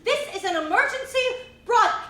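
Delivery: ordinary delivery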